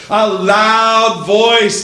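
LOUD stretched out long and high; slow, ringing emphasis on "loud"; stress on LOUD. Acted. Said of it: "with a loud voice".

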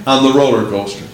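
ordinary talk, nothing marked

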